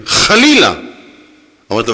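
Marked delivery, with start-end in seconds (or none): 0.0-0.7 indignant denial. Retold or imitated. Judge's indignant denial retold.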